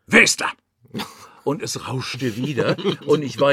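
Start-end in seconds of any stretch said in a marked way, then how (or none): 0.1-0.5 hissed out loud and high, a mock snarl; snarling caller. Retelling the mystery caller snapping into the phone, mock snarl. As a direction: spit the question like a grumpy stranger on the line. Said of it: "fauchte da irgendeine Stimme".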